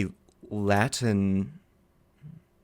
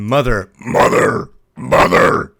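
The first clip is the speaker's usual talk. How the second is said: loud, rough acted scream for mother; drawn-out, hammed-up screaming; 11 dB louder, slower than usual. A narrator's reading. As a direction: scream it out as the possessed girl. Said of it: "Amy was screaming".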